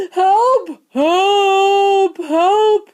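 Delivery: very high, loud cries; frantic high-pitched yells; yelling for help. A narrator's reading. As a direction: yell for rescue as if drowning. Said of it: "he yelled".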